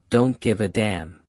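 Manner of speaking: normal talking voice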